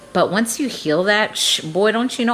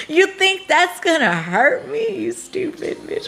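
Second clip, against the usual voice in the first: sassy taunt; 9 semitones higher than usual. Retold or imitated. Taunting the neighbors who cursed her.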